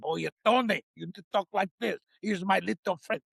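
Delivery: hammed-up Spanish-accented gangster voice. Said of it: "Enrique can teach him how to do a better accent".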